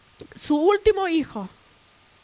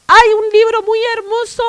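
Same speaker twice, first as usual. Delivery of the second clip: fervent preaching; 7 semitones higher, 14 dB louder than usual. Acted. Preaching at full force.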